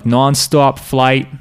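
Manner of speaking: "nonstop flight" slowly with broad Boston aw vowels; Boston accent demo. Shown as a demonstration. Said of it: "people miss out on vowels like aw".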